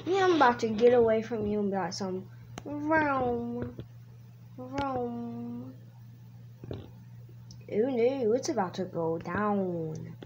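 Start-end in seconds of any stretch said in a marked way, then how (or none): 2.6-3.8 drawn-out car noise; imitated engine vroom. Kid driving a game car, drawn-out engine vroom. 4.6-5.7 quiet, drawn-out car noise; soft engine vroom. Pretend. Player echoing the car, soft engine noise.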